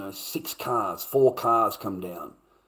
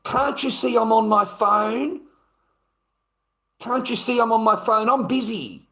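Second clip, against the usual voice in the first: high, loud, indignant monotone; exasperated mocking whine at being interrupted; mock phone-obsessed partner; 13 semitones higher, 8 dB louder than usual. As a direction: protest loudly on one high, held pitch. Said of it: "make sure you say to them".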